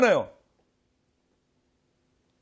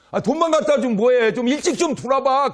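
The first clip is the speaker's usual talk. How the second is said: nagging complaint; 10 semitones higher, 8 dB louder than usual. Retold or imitated. Wife nagging husband.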